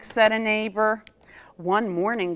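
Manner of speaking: ordinary delivery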